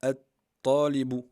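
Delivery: "At-Talibu" loud and slow, no L, held T; doubled emphatic t. Shown as a demonstration. Teacher pronouncing the example for students, T doubled loudly and slowly. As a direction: punch the double T, drawing out "At-Talibu". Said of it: "on va le prononcer".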